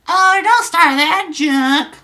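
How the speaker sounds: very high, loud put-on voice; girl's chat voice. Streamer as Sarah answering Donnie in chat, high loud falsetto. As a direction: squeal it like a cartoon girl. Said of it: "forgive my voices".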